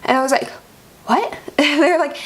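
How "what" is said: high, rising retold surprise; mildly acted incredulous question; stunned disbelief. Patient told the bat was negative, stunned disbelief.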